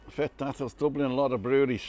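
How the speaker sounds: mock Irish Dublin lilt on a viewer's chat comment; Dublin accent. Vlogger reading chat in a hammed-up Dublin accent. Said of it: "my best Dublin accent there".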